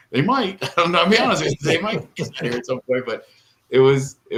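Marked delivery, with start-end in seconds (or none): 0.1-1.5 teasing reply. Retold or imitated. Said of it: "And I'm like".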